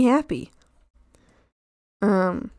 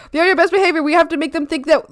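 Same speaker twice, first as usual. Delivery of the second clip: ordinary talk, nothing marked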